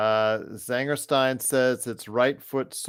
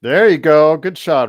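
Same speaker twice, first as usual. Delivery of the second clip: loud, excited cheer at the TV; 3 semitones higher, 11 dB louder than usual. Real, not acted. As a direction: cheer the shot as it drops. Cheering a made shot.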